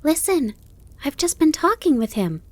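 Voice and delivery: put-on high, eager child voice; excited. A narrator's reading. Fawn running to tell his mother, excited. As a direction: burst out with the news, high. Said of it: "Bambi was very excited about his conversation".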